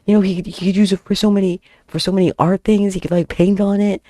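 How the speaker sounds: high-pitched, hammed-up pleading; begging. Friend begs French teacher for the chair piece, pleading. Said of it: "she starts begging with me".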